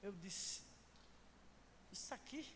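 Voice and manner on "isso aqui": soft, short questioning "isso aqui?"; imitating a miffed husband, hushed and higher; mock indignation. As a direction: echo it back as a quiet, rising, put-out question. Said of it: "Eu que já era revoltado".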